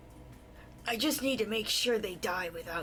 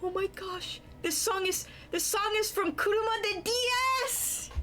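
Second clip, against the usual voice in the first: excited; 12 semitones higher than usual. Real, not acted. Excited recognizing a song.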